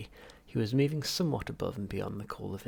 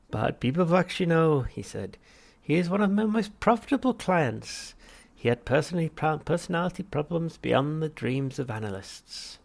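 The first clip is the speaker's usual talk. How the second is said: much higher, louder unctuous therapist; Halfrunt voice; 7 semitones higher, 8 dB louder than usual. Acted. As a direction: greet the patient with oily cheer. Psychiatrist voiced.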